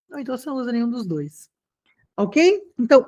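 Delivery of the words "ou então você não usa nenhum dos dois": fast unvoiced whisper; whispered aside. Real, not acted. Teacher to class, a quick whispered joke. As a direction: toss it off under your breath.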